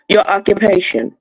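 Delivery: ordinary talk, nothing marked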